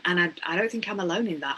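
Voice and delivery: ordinary delivery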